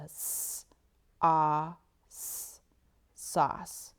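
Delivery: "s-, ah, s-" then "sauce"; sounded out sauce. Shown as a demonstration. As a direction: segment "sauce", stress the ah. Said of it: "I can hear it as I say it".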